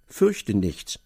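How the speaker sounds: ordinary delivery, nothing marked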